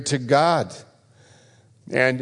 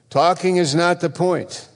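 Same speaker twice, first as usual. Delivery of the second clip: higher, insistent preaching; raised pitch, driving the point home; emphatic refrain; 7 semitones higher than usual. Real, not acted. Preacher hammering home his refrain.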